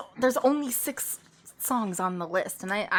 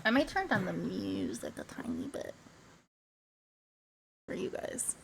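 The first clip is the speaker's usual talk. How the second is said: whispering; 5 semitones lower, 13 dB quieter, much faster than usual. Real, not acted. Aside while adjusting music.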